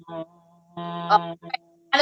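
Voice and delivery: dropping the voice down into the tummy; low voice from the belly; voice sinking low. Shown as a demonstration. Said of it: "Do you wanna give us an example?".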